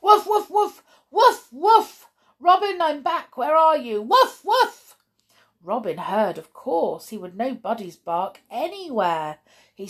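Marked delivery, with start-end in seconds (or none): 0.0-4.8 very high, very loud excited barking; loud barking dog. Acted. Dog Buddy calling for Robin from the kennel, loud excited barking. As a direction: bark it out as the overjoyed dog. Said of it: "How loudly he barked".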